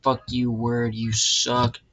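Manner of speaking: loud, drawn-out swearing at the computer; fed up with Word; angry cursing. Real, not acted. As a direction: curse out the program, slowly and loudly.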